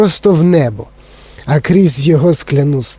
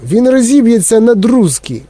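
high-pitched panicky warning; alarmed shout; 7 semitones higher than usual. A narrator's reading. Grandpa Joe fears the lift will shatter, alarmed. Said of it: "закричав дідунь Джо".

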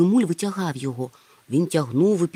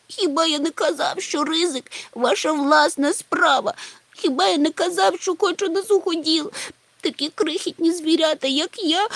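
tearful whine; 12 semitones higher than usual. A narrator's reading. Чмих keeps wailing.

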